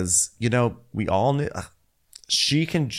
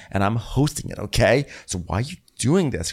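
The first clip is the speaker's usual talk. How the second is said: normal talking voice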